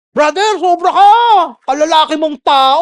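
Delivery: loud, high mock rebuke of a brother; a pretend haughty telling-off; proud scolding. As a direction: scold him from above, hammed-up. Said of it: "Not in a, yung proud way".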